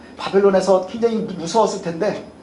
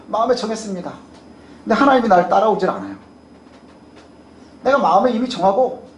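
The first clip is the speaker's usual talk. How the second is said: usual speaking voice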